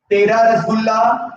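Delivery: usual speaking voice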